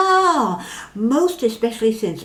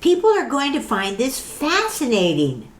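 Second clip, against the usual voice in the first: excited; 6 semitones higher than usual. Acted. Mary eager about filming.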